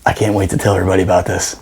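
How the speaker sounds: fast, hushed giggle; giggling whisper. Retold or imitated. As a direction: rush it out under a laugh. Hiding, overhearing, delighted.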